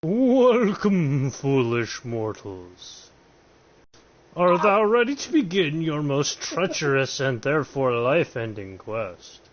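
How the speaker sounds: loud, theatrical vampire lord; over-enthusiastic Count Dracula. Acted. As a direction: ham it up as a gleeful evil count. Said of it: "the over-enthusiastic Count Dracula".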